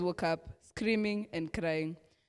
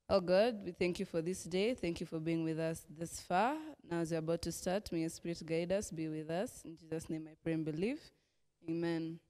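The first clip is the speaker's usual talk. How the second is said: prayer; 5 dB quieter than usual. Acted. Leader praying before the children's lesson, soft and earnest. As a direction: pray quietly to God.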